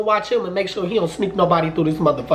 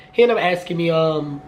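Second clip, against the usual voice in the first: usual speaking voice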